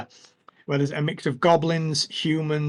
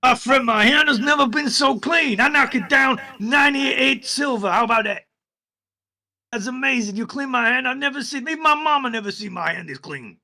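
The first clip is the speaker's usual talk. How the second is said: high, loud goblin gushing over his clean hand; delighted goblin; 10 semitones higher, 4 dB louder than usual. Acted.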